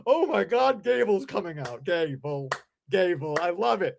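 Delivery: mocking a gushing fan in the arena; mock excited fan. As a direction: play a fan who loves Gable, a bit hammed-up. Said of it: "is gonna wanna be like".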